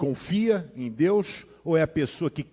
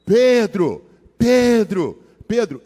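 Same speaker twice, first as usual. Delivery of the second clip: loud repeated shouts of Pedro; shouting a name; 5 semitones higher, 9 dB louder, much slower than usual. Retold or imitated. Mother yells for Pedro from the porch, loud repeated shouts. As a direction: yell the name across the house. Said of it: "Minha mãe tá gritando da varanda".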